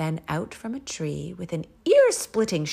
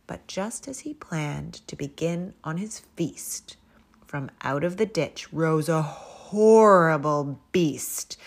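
usual speaking voice

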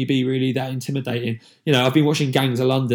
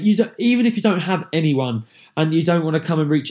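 usual speaking voice

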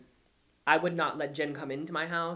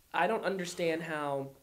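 normal talking voice

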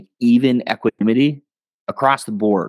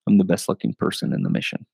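dry deadpan; 5 semitones lower, 4 dB quieter than usual. Retold or imitated. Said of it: "it was dry".

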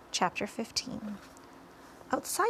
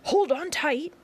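loud, high warning call; excited shout; 7 semitones higher, 7 dB louder than usual. A narrator's reading. Grasshopper warning the others to hold on, excited shout. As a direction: call out urgently over the commotion. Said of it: "shouted the old green grasshopper".

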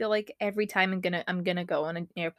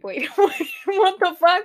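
higher and louder, incredulous; shocked disbelief; 10 semitones higher, 7 dB louder than usual. Real, not acted. Spotting a huge word on the list, shocked disbelief. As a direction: react in sudden disbelief.

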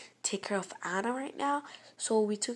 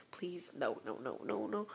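lower, much softer pleading; mock pleading; 4 semitones lower, 9 dB quieter than usual. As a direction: beg in a nervous hush. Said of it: "the person was like".

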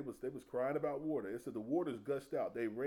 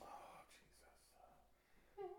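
whispered groan; muttered complaint to Jesus; under the breath; 22 dB quieter, much slower than usual. Retold or imitated. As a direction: sigh it out under your breath. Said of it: "complain underneath my breath".

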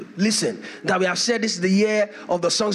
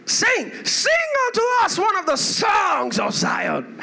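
mocking demand; 10 semitones higher than usual. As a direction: jeer at them to sing. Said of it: "They said".

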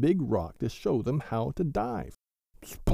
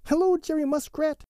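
high, louder calling out across the pool; shouted greeting; 5 dB louder than usual. A narrator's reading. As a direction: call out to a friend far off. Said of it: "shouted Billy Mink".

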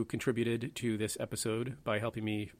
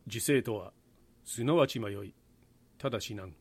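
lightly voiced Japanese monk. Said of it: "I'll do my best Japanese monk voice".

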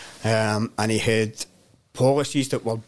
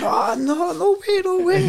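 much higher, laughing no way no way; freaking out; 4 dB louder than usual. Retold or imitated. Workmates freaking out over [name], high laughing disbelief. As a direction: squeal the disbelief through a laugh. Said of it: "they're all freaking out".

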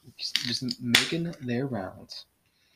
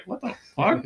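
high-pitched mock bewilderment; baffled reaction; 11 semitones higher than usual. Friend voicing defenders' reaction to quarterback's praise, high mock bewilderment. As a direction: imitate a player totally thrown by the compliment. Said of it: "And the guys always are like".

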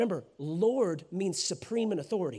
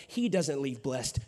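usual speaking voice

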